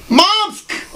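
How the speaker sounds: high, calling out to mom; mock son's greeting. Interviewer imitates the wrestler greeting his mom in a high mock call. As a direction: chirp it up high like a son spotting his mother. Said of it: "and you're like".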